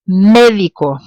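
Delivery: Médico, punching MÉ; Médico, firm first syllable, the rest light; first-syllable stress. Shown as a demonstration. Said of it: "stress falls on the beginning of the word".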